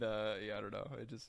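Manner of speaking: normal talking voice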